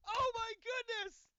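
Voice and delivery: shrieking, very high and loud; ecstatic screaming. Real, not acted. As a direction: scream with pure joy. Still screaming with joy.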